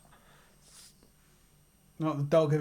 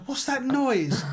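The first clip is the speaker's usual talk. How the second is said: imitating a puzzled partner, higher pitched; mock-baffled, pitched up; startled question; 9 semitones higher, much slower than usual. Retold wife's startled question.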